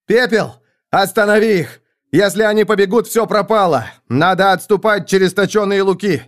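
high, loud, desperate commands; yelled orders. A narrator's reading. Prince orders Pepel to stop the fleeing army, high yelling. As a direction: yell urgently to halt the flight. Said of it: "заорал".

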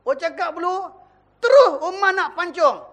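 ordinary talk

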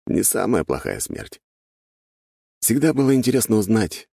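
plain reading voice

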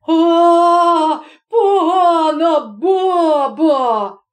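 high, loud, drawn-out wail; headman's acted yell of revulsion; outraged shout. A narrator's reading. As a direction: cry out in disgust, stretching every word. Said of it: "закричав голова".

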